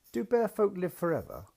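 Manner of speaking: put-on high, eager child asking; a small girl's bright question; little girl voice. A narrator's reading. Daughter asks her daddy in a high, eager little voice.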